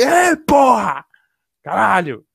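loud, high celebratory shout, first word stretched; crazed yell. Retold or imitated. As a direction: scream it like crazy in triumph. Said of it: "eu gritei igual louco". (gritando igual louco de alegria)

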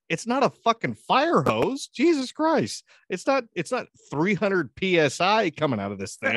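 high, loud indignation; mock-outraged dad setting a kid straight; exasperated protest. Dad's exasperated retort, retold.

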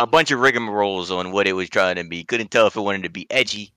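normal talking voice

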